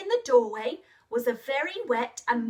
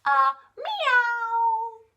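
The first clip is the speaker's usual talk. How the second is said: high, loud meow; cat meow; 9 semitones higher, 5 dB louder than usual. Pretend. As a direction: mew like a cat for the children. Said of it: "What sound do the cats make?".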